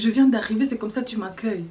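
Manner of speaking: loud, indignant complaint. Retold or imitated. Student scolding the receptionist, loud and indignant. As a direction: throw back the offence.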